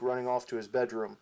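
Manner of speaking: normal talking voice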